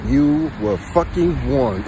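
drawn-out, very slow "fucking warned"; slow deliberate warning. Acted. Warning his viewers again, dragged out very slowly. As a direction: stretch every word slowly.

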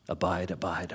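abide, abide said low and hushed; soft repetition. Shown as a demonstration. Preacher softly repeats abide to congregation, hushed. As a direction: let "abide" sink in quietly. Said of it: "repeating the Greek word softly".

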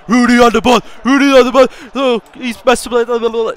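loud, high-pitched mock match commentary; annoying commentary. Distracting a raging opponent with cringe, annoying commentary. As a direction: commentate obnoxiously to wind up your opponent. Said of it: "I was just doing my annoying commentary".

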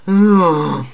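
loud, open yawn. Acted. Spider-Man yawning over Batman's email rant, loud put-on yawn. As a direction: yawn out loud, pitch lifted. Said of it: "I'm yawning".

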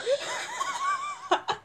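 very high, thin laughter. Real, not acted. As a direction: laugh in a soft high squeal. Laughing at the game.

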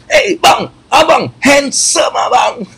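very high, slow shouted flattery; hammed-up fake rage that turns into praise; mock angry compliment. Man showing how to scold a husband with praise, high comic shout. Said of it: "Cuba kau marah macam ni".

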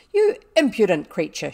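loud, outraged rebuke; angry scolding. A narrator's reading. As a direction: scold a servant at full voice. Boxes ears, scolds servant.

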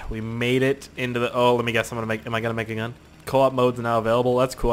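usual speaking voice

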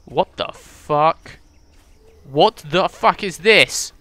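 slow, drawn-out shocked outburst; can't believe the horde; stunned disbelief. Real, not acted. Zombie horde running past.

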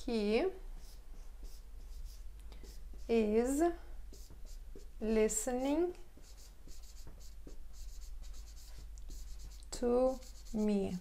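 each word separated by long pauses; slow teacher demo; slow dictation. Shown as a demonstration. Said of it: "teacher models English slowly".

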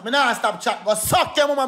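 normal talking voice

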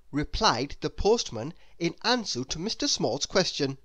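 ordinary delivery

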